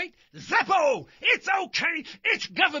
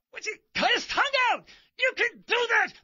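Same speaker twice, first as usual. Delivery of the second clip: high, loud, fast gleeful order; mock gleeful order; 11 semitones higher, 5 dB louder than usual. To bullies in a satirical rant, a gleeful loud order. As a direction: bark it out like a cheerful decree.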